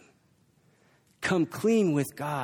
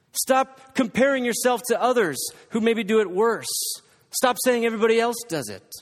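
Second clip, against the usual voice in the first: higher, louder rising exhortation; preaching at full force; 7 semitones higher, 4 dB louder than usual. Acted. Preacher urging the congregation to stop excusing sin, rising force. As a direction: drive each "stop" home harder. Preaching climax, repeated commands.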